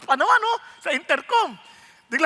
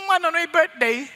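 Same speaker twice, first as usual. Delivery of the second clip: ordinary talk, nothing marked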